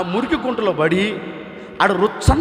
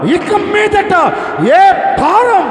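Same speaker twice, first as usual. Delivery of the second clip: high, loud, drawn out; slow shout; 12 semitones higher, 9 dB louder, much slower than usual. Acted. Pastor declaring no more burden, slow shouted. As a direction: stretch each word as a shout. Emphatic sermon peak.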